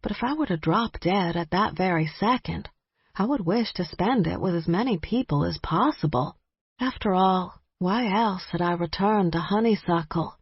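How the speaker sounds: plain reading voice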